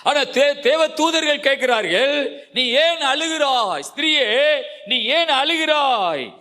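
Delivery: high, sustained sermon pitch; impassioned preaching. Real, not acted. Preacher proclaiming the angels' question to Mary, impassioned preaching.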